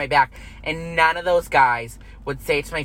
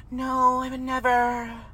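a slow, higher, sugary fake-sweet denial; fake innocent denial; 5 semitones higher, 3 dB quieter, slower than usual. Mocking a fake friend's sweet denial, slow and higher. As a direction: play the two-faced friend denying it. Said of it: "'Cause they're fake as fuck".

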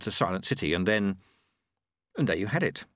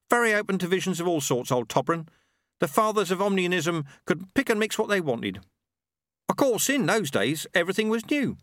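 raised, cheerful milkman voice; a proud tradesman talking shop; Ronnie the milkman; 9 semitones higher than usual. Acted. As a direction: Ronnie the milkman, pleased with his product. Ronnie recalling the old days.

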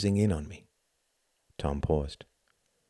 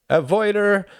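high, loud teasing accusation; playful shouted retort; mock cry; 13 semitones higher, 8 dB louder than usual. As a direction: throw it back in mimicry. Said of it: "Cried Klaus, doing the same".

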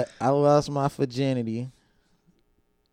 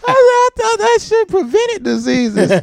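much higher, slow, laughing protest; incredulous kid; 7 dB louder than usual. Retold or imitated. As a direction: argue back in disbelief. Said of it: "I said".

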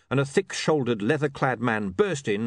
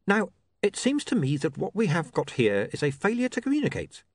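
higher, measured criminal reasoning; patient menacing boss; 10 semitones higher than usual. Acted. Mister Pin coaching reluctant Charlie, patiently menacing. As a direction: reason with him as a patient crook. Mr Pin's figure voice.

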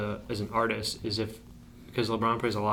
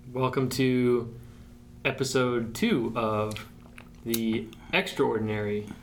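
slow podcast intro; slower than usual. Acted. Opening the podcast episode.

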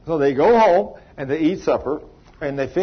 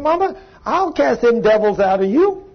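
put-on high, louder child voice, full of faith; eager little boy; 7 semitones higher, 4 dB louder than usual. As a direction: play the boy promising his mama. Boy vows to heal his mother.

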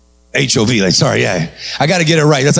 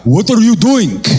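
put-on African accent; 5 semitones higher, slower than usual. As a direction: drawl it like the African guy. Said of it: "The big old African guy".

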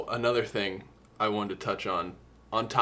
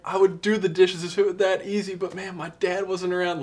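pitched way up, whining self-pity in a put-on voice; high mocking whiny voice; 10 semitones higher, 6 dB louder than usual. Mocking the rapper's excuse in a high whiny voice. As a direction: play the sulky boyfriend whining high.

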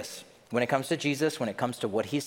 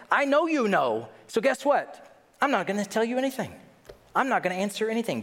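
curt refusal; 9 semitones higher than usual. Acted. Jesus voiced refusing answers.

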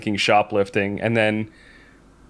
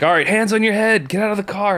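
barked police command, pitched up; mock cop yelling orders at the car; a retold sheriff calling out orders; sheriff's order; 10 semitones higher, 3 dB louder than usual.

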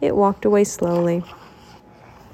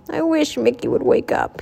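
mildly grumbled wish; lightly acted character grumble; grumbling; 4 semitones higher than usual. A narrator's reading. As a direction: grumble it, a little higher. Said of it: "he grumbled".

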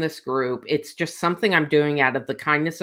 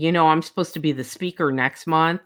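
ordinary talk

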